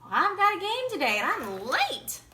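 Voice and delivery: high, loud hurried yell. A narrator's reading. Boy late for his game, loud yell. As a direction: yell it back over your shoulder. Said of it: "Michael yelled".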